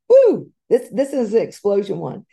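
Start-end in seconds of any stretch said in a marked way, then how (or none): 0.1-0.5 high, loud whoop of excitement; excited whoop. Real, not acted. Speaker cheering about the big one, loud whoop. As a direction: let out a big cheering woo.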